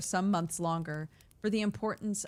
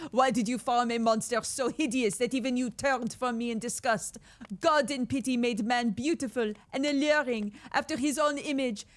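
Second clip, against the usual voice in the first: the monster's lines in a hammed-up French accent, higher and louder; a theatrical French accent, a lot higher, for Hateful day when I received life; 6 semitones higher, 4 dB louder than usual. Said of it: "My French accent is so lacking".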